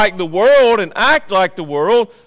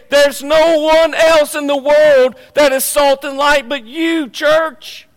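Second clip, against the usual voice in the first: high, loud preaching cry; ringing declaration in Jesus' words; emphatic proclamation; 7 semitones higher, 5 dB louder than usual. Acted. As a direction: proclaim it to the whole church at full force. Preacher voices Jesus emphatically to church.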